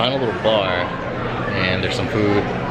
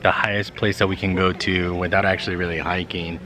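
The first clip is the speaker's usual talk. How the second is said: ordinary talk, nothing marked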